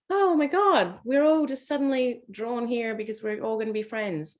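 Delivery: high, gushing voice; mock giddy new friend; gushing starry-eyed. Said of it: "just out of like".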